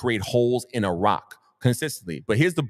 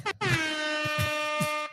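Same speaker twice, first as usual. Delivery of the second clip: high burst of laughter; 6 dB quieter than usual. Real, not acted. Laughing at own crab-leg reward.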